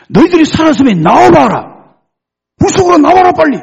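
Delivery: very high, loud shouted command; acted royal yell, full voice; startled king calling. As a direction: shout urgently to men inside a fire. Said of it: "이 왕이 놀래가지고".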